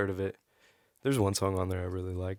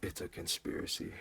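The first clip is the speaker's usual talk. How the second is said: breathy conspiratorial whisper; spooky whisper; 3 semitones lower, 12 dB quieter than usual. Pretend. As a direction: hiss it like a secret. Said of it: "Does this voice scare you?".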